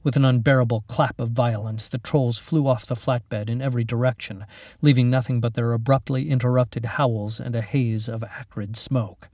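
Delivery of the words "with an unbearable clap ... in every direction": ordinary delivery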